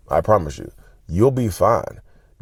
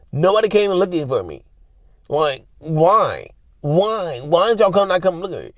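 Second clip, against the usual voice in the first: high, put-on aggrieved wail; hammed-up hurt pleading; mock wounded whining; 9 semitones higher than usual. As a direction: play the abandoned partner, pitched way up. Acting out the neglected partner's complaint.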